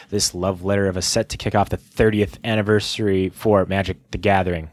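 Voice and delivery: ordinary delivery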